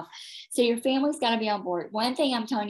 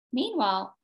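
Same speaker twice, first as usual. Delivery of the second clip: ordinary delivery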